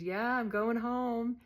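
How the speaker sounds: a slow, loud, lightly voiced Candy; slow proud reply. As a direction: savour every word of going home. Candy answers about release.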